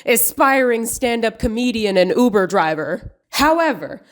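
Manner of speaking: sarcastic mock outrage, overdoing every phrase; mock overreaction. Commentator scolding Kumail over his film scene, mock overreaction. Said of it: "I'm overreacting".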